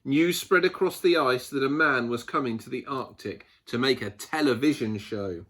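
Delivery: usual speaking voice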